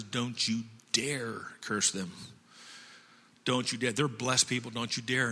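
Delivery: low, hushed divine warning; stern warning. Acted. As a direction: forbid it gravely, low and soft. God sternly forbids the cursing.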